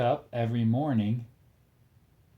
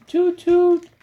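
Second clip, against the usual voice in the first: loud high toot; 11 dB louder than usual. Acted. Parent reading to toddler, very high loud boat toot. Boat horn in kids' book.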